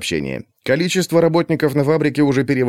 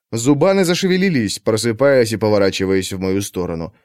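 plain reading voice

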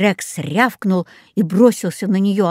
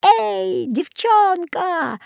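very high, stretched-out creature call; monster bird's cry; 11 semitones higher, much slower than usual. A narrator's reading. Ugly flying beast calls to the girl, crying out. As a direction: screech it long from up in the sky. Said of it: "закричала уродливая птица-зверь".

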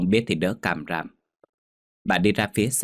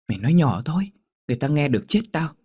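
high, hurried and alarmed; urgent hushing; 7 semitones higher, faster than usual. Acted. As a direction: shush her before anyone overhears. Alarmed friend urgently hushes her.

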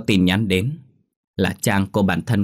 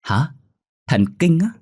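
high-pitched, louder disbelieving question; stunned surprise; 9 semitones higher, 4 dB louder than usual. A narrator's reading. Wife hears of husband's move to neurology, stunned. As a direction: gasp it back as a shocked question. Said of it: "Tôi há hốc mồm".

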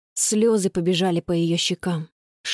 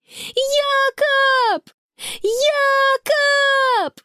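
high, long-drawn desperate call; calling out loudly; 3 dB louder, much slower than usual. A narrator's reading. Sister searching the shore for her lost brother, desperate shouting. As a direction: call a lost child across the distance. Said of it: "она опять закричала, что есть силы".

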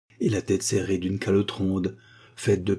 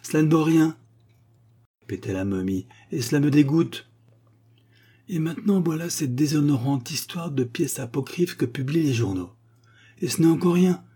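higher, louder indignant mummy; ranting mummy; 7 semitones higher, 3 dB louder than usual. Acted. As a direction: pour out every grievance. Mummy repeats disgust.

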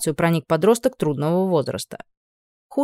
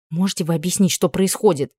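usual speaking voice

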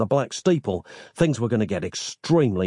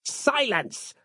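snapped; 4 dB quieter than usual. A narrator's reading. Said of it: "snapped Harland".

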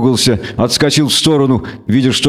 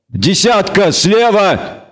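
high, urgent barked warning; 6 semitones higher, much slower than usual. Acted. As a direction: bark a warning to a comrade. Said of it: "рявкнул Нагорн".

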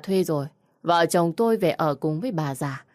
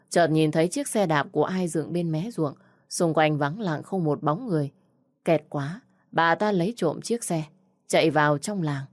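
ordinary delivery

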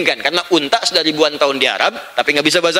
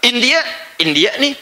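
mock Indian accent; 4 semitones higher than usual. Shown as a demonstration.

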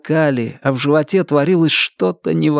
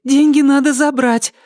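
very high, strained woman's voice; high, pressing demand; urgent insistence; 14 semitones higher, 3 dB louder than usual. A narrator's reading. Sick girlfriend insists on the money.

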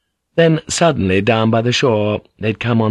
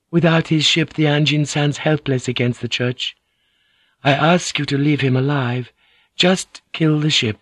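higher-pitched woman's voice, measured; a higher, controlled plea; Mariko; 5 semitones higher than usual. Acted. Mariko's remembered words to another.

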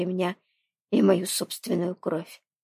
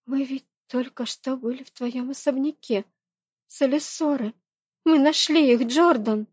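put-on higher, worried young woman; 7 semitones higher than usual. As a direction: voice Reyni, anxious and urgent. Narrator voices Reyni.

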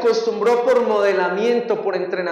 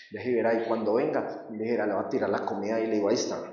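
scheming undertone; 8 semitones lower, 7 dB quieter, faster than usual. Retold or imitated. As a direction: lower and softer, quick plotting mutter. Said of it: "inner scheming voice plotting revenge". (murmullo conspirador, más grave y bajo)

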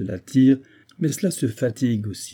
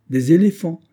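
higher, louder relishing echo; savoring echo; 7 semitones higher, 6 dB louder than usual. A narrator's reading. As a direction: repeat it as if tasting the idea. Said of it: "Voilà qui serait superbe et majestueux".

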